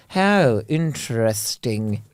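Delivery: slow, drawn-out posh sneer; little sneer. Retold or imitated. Showing how posh people say interesting, slow sneer. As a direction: stretch it out with sneering disdain. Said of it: "It's that little sneery".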